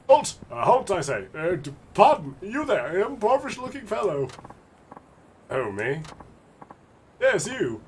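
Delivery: voiced nervous gentleman, calling out; fussy gentleman calling out. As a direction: hail a passerby, flustered. Voicing a fussy stranger.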